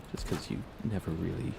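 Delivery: usual speaking voice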